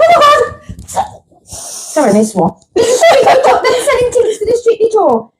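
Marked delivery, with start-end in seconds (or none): 0.0-1.5 slow, very high squeal, much higher; ecstatic squeal. Retold or imitated. Host relives seeing the tour email, squealing. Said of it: "I was like". 2.8-5.3 much higher, laughing excitement; thrilled, laughing. Retold or imitated. Host retells finding tickets, laughing and thrilled. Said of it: "I was like".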